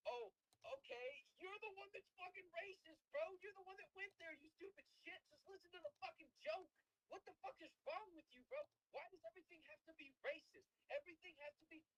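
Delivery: high-pitched exasperated comeback; indignant rant. Acted. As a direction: argue back in a high, worked-up voice. Acted indignant comeback to his accuser.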